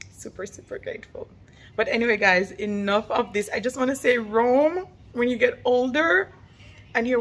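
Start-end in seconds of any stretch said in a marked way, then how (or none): 0.1-1.3 "super, super grateful" whispered fast, much softer. Real, not acted. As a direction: breathe "super, super grateful" almost silently. Grateful mom, hushed repeat.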